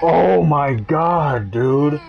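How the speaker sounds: moaning in real dread, slowly; frightened wail. Real, not acted. Streamer to his viewers, spooked in the dark, frightened wail. Horror game jump scare, crying out.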